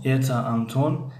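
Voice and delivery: Антон slow, with the stress on "тон" rising; stress on Антон's second syllable. Shown as a demonstration. Teacher showing students where Антон takes its stress. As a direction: slowly model Антон, pressing the second syllable. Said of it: "ҳиҷои дуюми калимаи Антон".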